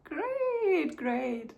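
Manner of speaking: sarcastic laugh. Real, not acted. About to cry, ironic.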